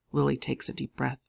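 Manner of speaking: ordinary delivery, nothing marked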